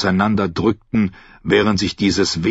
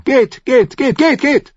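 much higher, louder, a frantic "go, go, go"; shooing shout; 5 dB louder than usual. Acted. Old captain drives the knight off with a frantic shout. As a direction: shoo him away, wildly. Salador waves him off.

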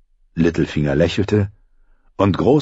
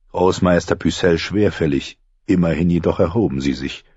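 ordinary talk

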